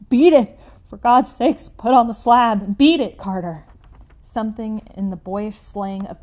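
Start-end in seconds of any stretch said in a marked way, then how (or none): 0.1-3.7 high, loud desperate shout; piteous cry. Acted. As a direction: scream it out in anguish. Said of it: "a piteous cry from Warren".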